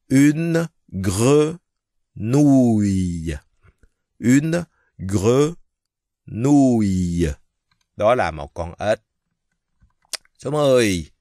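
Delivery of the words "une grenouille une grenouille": une grenouille loud and high, drawn out; teacher drilling une grenouille; emphatic French model. Shown as a demonstration. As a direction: announce each word of une grenouille clearly. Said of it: "Gre là G, chữ R với chữ E là gre".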